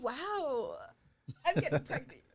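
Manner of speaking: laughing. Retold or imitated. Retelling her giddy thoughts.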